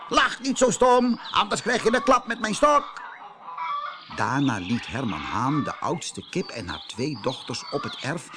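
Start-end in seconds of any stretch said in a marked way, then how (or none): 0.1-3.0 high, loud, rude threat. Acted. Rooster rudely threatens aunt hen, loud and high. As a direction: sneer and threaten with the stick. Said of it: "zei hij heel onbeleefd".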